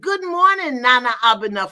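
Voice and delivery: high, loud good morning; joyful hello across the room; calling out a greeting. Real, not acted. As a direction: sing out the welcome. Greeting a caller from Ghana.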